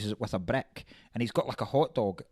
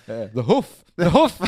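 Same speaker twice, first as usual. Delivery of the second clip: louder, firm mock De Niro on hoof; lightly voiced De Niro, stressing hoof; De Niro correcting; 2 semitones higher, 10 dB louder than usual. Correcting the word foot at Pesci's mum's, lightly voiced De Niro. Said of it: "De Niro goes".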